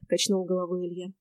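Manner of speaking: ordinary delivery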